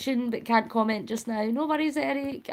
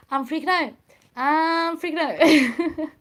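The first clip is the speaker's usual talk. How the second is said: playful freak-out; 5 semitones higher, 4 dB louder than usual. Real, not acted. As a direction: freak out playfully, stretching the last one. Repeats mock panic about the cat.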